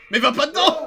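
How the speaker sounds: yelling through laughter; laughing shout. Real, not acted. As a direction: laugh while shouting a warning. Laughing yell at teammate.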